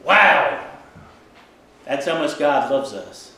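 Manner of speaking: ordinary talk, nothing marked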